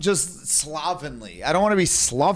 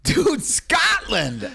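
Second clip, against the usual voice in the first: much higher, slow, amazed exclaim; amazed exclamation; 11 semitones higher, much slower than usual. Real, not acted. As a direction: stretch it out in disbelief. Reacting to Scottish accent.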